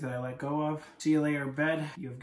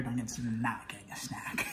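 whispering; 6 semitones lower, 8 dB quieter than usual. Real, not acted. Whispering about the dog.

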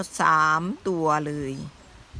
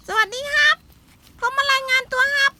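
cute high voice; 5 dB louder than usual. Acted. Voicing the father animal.

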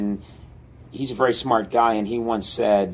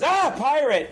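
high-pitched startled cry; hammed-up alarm; mock scared; 14 semitones higher than usual. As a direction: yelp in pretend fright at a pirate. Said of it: "the other being scared".

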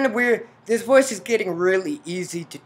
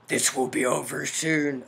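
deep mystery voice; 4 semitones lower than usual. Acted. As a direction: sound like a hidden villain, deep in the throat. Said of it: "mystery voice".

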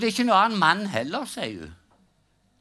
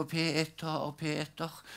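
weak, much softer call to Peter; faint calling from the cross; 9 dB quieter, much slower than usual. Retold or imitated. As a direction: call out weakly, fading. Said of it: "Og Jesus roper ennå". (svakt, dempet rop fra korset)

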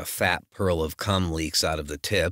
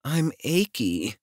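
slow, higher, pouty whine; mock pout; 8 semitones higher, slower than usual. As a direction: pout it out, playfully. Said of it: "She pretends to pout".

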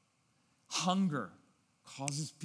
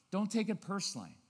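ordinary talk, nothing marked

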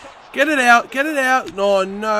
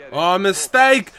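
ordinary talk, nothing marked

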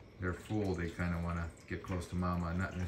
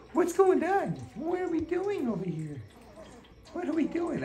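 very high, louder voicing of the puppy; 4 dB louder than usual. Owner voicing her puppy Miss Red in a squeaky high voice. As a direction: speak for the curious puppy, squeaky. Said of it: "Miss Red is like".

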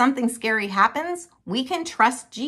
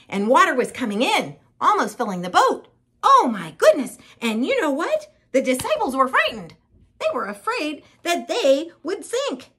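high, louder, urgent storytelling; pitched-up dramatic alarm; alarmed narration; 6 semitones higher, 4 dB louder than usual. A narrator's reading. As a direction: tell it with mounting panic. Boat filling, disciples frightened.

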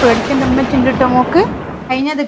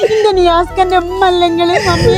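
laughing through tears; 6 semitones higher than usual. Acted. Daughter laughing and crying.

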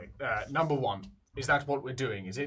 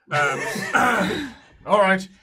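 nervous laughing greeting; 4 semitones higher, 11 dB louder than usual. Real, not acted.

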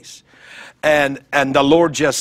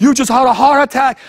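incredulous friends; 9 semitones higher, 5 dB louder than usual. Retold or imitated. Friends in disbelief at his claim.

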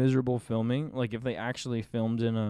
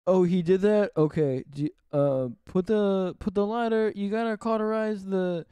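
put-on New York accent; 5 semitones higher than usual. Dubbing the New York doctor.

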